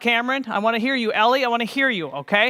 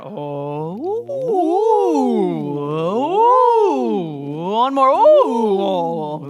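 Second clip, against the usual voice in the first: up and down ooh; 5 dB louder, much slower than usual. Shown as a demonstration. Student asked for up and down, teacher sliding. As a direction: slide the voice like a siren. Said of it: "we start down".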